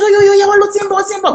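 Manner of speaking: loud, high reenacted panic; retold frantic hollering; frantic outcry. Reenacts frantic flight from the house.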